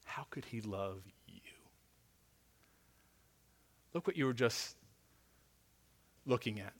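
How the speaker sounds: low hushed taunting murmur; Satan whispering. Acted. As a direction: murmur it like a tempter in the ear. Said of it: "whisper in your ear".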